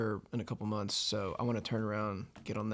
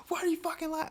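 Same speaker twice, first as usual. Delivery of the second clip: mock angry girl; faster than usual.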